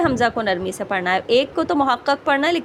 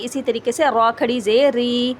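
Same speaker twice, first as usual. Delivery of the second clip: ordinary delivery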